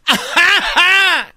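big high drawn-out laugh; loud high cackle. Acted. Host capping his Chivas cheer, loud cackle. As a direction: cackle loudly after the cheer.

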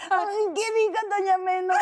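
put-on very high whiny baby talk. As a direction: squeal it like a crying baby. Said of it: "di doña Meno con voz de bebé". (voz de bebé chillona y llorona)

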